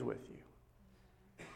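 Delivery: soft, voiceless close to the phrase; hushed emphasis. Real, not acted. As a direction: drop suddenly to a tender whisper. Preacher drops to a hush.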